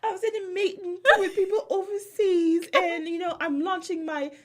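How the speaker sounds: high, loud, hammed-up mixed accent; Dorit's fake mixed accent. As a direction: make grand excuses in a falsetto fake accent. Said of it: "every time I do her impression".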